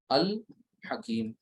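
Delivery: अल then हकीम, split apart with the lam sounded; lam pronounced clearly. Shown as a demonstration. Teacher splitting the word so students hear the lam. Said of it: "अब गौर करें सभी लोग".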